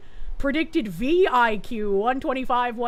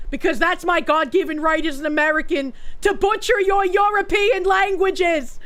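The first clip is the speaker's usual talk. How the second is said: mock patriotic shout; 4 semitones higher, 3 dB louder than usual. Proud American declaration.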